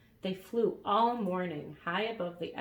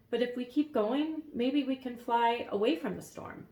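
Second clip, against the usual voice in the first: put-on higher worried boy voice; 5 semitones higher, 4 dB louder than usual. A narrator's reading. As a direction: voice Elmer a little higher. Elmer voiced.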